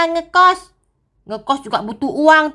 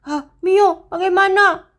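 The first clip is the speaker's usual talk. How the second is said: ordinary talk, nothing marked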